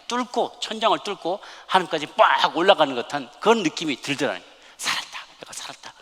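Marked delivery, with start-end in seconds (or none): 4.8-5.9 whispered, barely voiced realisation; retold private whisper of relief; hushed relief. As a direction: breathe it out to yourself, very softly. Father's inward relief at answered prayer.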